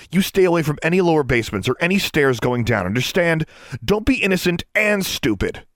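loud, high, hammering STAY as a warning; loud stern warning; stern scolding. A narrator's reading. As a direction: scold a careless kid who scared you. Alexandra scolds Innocence hard.

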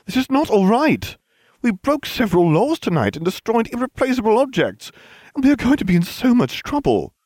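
high, loud scolding rant. A narrator's reading. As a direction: lecture them, indignant. Hermione scolding.

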